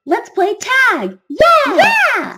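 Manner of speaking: high, loud cheer; loud, high-pitched playful cheering; excited kids' shout. A narrator's reading. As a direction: call out like an excited child starting a game. Children calling out to play.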